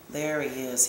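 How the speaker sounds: low, soft and understated; softly. A narrator's reading. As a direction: say it low and quiet, as a hushed observation. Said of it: "he said softly".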